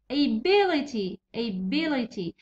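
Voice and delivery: Ability with 'bi' clearly stressed. Shown as a demonstration. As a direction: lean on the second syllable of Ability. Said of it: "ستريس راهو في بي".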